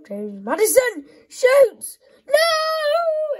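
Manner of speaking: loud, high, laughing shout then a shriek; excited commentary into despair. Real, not acted. Gamer calling a shot, then shrieking as it fails. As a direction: build the shot up and crash.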